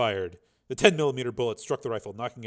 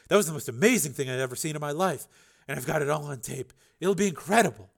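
excited reporter; 5 semitones higher, 5 dB louder than usual. Acted. Reporter voiced in dialogue.

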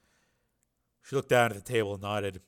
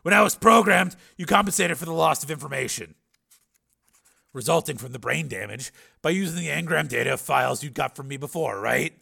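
louder, higher, pressing question; Steele, challenging; 4 semitones higher, 7 dB louder than usual. A narrator's reading. Patient challenges the scientist who rebuilt him, raised pressing voice. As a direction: push the question forward loudly.

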